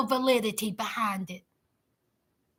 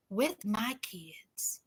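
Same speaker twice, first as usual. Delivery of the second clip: whispered quote; 7 semitones lower, 13 dB quieter, much slower than usual. Retold or imitated. Quoting him, trailing off.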